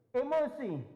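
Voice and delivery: Emosi cried out high and loud; emphatic. Real, not acted. Preacher stressing Peter's temper, loud and high. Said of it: "naming Peter's temper".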